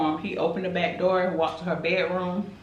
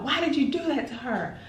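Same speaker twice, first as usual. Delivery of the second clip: a pitched-up mock yell at him; mock scream; 9 semitones higher than usual. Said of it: "Robert screams out".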